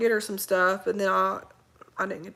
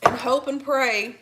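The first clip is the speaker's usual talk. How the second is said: slow earnest hope; 3 semitones higher, 5 dB louder than usual. Real, not acted.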